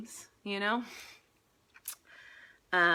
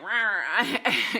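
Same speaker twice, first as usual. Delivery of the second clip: mock gunman roar; 4 semitones higher, 9 dB louder than usual. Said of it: "people with guns being like".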